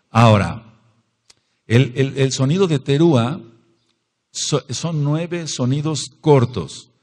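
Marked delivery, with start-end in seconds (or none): none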